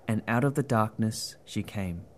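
plain reading voice

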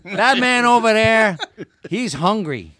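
loud, high emphatic tough-guy impression; brash accented celebrity impression; Dennis Farina. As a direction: declare it in punchy bursts. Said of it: "This is the late Dennis Farina".

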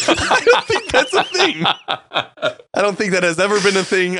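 high-pitched laughing disbelief; can't stop laughing while saying it. Real, not acted. Laughing at banana melting.

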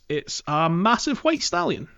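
ordinary talk

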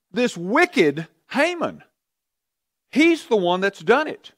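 high, slow accusation, pointing the finger; Esther accusing. Acted. Queen exposing Haman to the king, pointed high accusation.

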